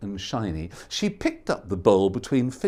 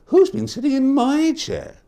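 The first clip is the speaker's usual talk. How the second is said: higher, lighter mother bear voice; Mama Bear; 10 semitones higher, 4 dB louder than usual. Acted. Mama Bear discovering her porridge, higher fussy. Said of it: "And Mama Bear said".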